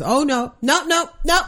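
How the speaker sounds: high, rushed no no no; imitating a flustered boy shaking his head; mock panicked refusal. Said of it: "He goes".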